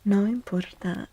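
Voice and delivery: ordinary talk, nothing marked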